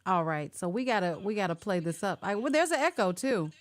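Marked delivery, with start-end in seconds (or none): none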